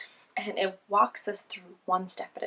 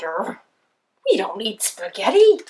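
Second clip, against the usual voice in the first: growling lion; 10 dB louder than usual. Acted. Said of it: "The lion growled".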